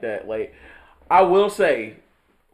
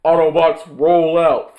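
loud slow rallying cry; Optimus Prime; 3 semitones higher, 11 dB louder, slower than usual. Retold or imitated. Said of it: "this Prime head ass boy".

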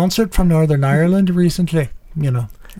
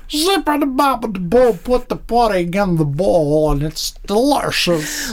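scat it like Bill Cosby; Bill Cosby impression; 4 semitones higher than usual. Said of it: "I do Bill Cosby".

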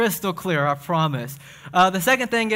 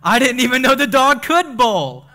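high, loud, laughing punchline; 7 semitones higher, 8 dB louder, slower than usual. Acted. Pastor delivering a dad joke punchline, high and laughing. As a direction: deliver the pun with a goofy high crack. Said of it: "I said".

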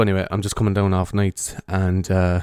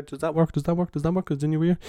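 panning test; 7 semitones higher, 7 dB quieter than usual. Shown as a demonstration. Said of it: "the old, left to right thing there".

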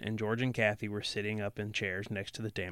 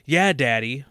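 louder, lightly voiced little girl's cry; child crying out; 3 semitones higher, 9 dB louder than usual. A narrator's reading. Little girl accusing her daddy, mild cry. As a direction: pipe up loudly as the upset daughter. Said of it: "cried Missy".

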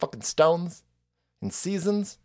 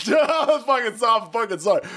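laughing apology; 6 semitones higher than usual. Real, not acted. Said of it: "laughing apology".

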